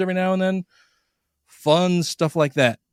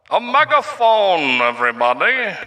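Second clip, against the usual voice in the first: louder, slow megaphone announcement; 4 semitones higher, 3 dB louder than usual. Shown as a demonstration. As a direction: call it through a megaphone. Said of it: "speaking on a megaphone".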